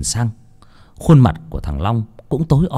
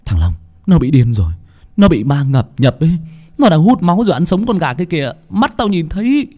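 loud, urgent report climbing in pitch; alarmed outburst; 7 semitones higher, 4 dB louder than usual. A narrator's reading. Phong tells Bình that Long drinks blood, alarmed outburst. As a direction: blurt it out, higher and more frantic.

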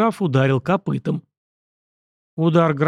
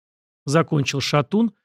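plain reading voice

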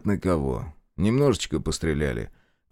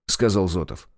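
ordinary delivery

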